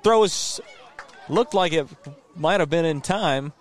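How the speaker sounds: rising excitement on the throw; excited play-by-play. Real, not acted. Close play at first.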